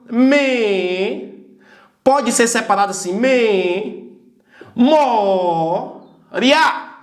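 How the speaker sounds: "Me. Mo. Ria." as three slow separate beats; syllable by syllable. Shown as a demonstration. Teacher splitting memória for students, slow syllables. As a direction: pause between each piece. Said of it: "Três sílabas".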